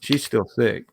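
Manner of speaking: ordinary talk, nothing marked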